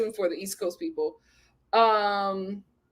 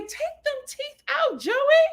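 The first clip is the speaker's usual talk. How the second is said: exasperated plea; 13 semitones higher, slower than usual. Retold or imitated. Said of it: "I'm just like".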